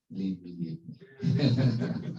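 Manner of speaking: drawn-out cries breaking into laughter; joking mock shout. Teacher teasing listeners after the story, laughing mock plea. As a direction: playfully echo the plea, laughing. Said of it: "don't shout".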